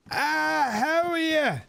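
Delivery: high, slow barman talking for a tourist; slow tourist English. Retold or imitated. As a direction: stretch it out for the foreigner. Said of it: "slowing down their English tune".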